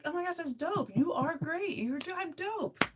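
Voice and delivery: high-pitched self-hype; gushing self-praise. Acted. Tell yourself you are great, gushing. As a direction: squeal compliments at yourself. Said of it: "Tell yourself, like".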